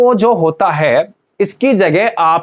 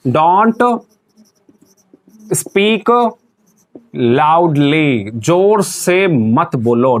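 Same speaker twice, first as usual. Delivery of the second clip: each word drawn out and set apart; word-by-word dictation; much slower than usual. Shown as a demonstration. Teacher writing an English example for class, slow dictation. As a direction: dictate slowly, pausing after every word.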